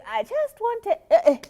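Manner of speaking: high hesitant "I just wanted"; timid. Shown as a demonstration. Mocking the timid speaker, high and hesitant. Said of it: "Nobody care when you're saying".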